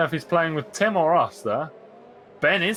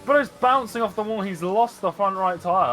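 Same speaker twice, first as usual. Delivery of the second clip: excited; 6 semitones higher, 3 dB louder than usual. Real, not acted. Describing the crash live.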